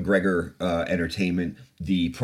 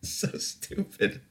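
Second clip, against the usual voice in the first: wheezing through laughter; laughing so hard it comes out as a whisper; breathless laugh; 13 semitones higher, 5 dB quieter, much slower than usual. Real, not acted. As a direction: barely get it out while laughing. Laughing at the tweet.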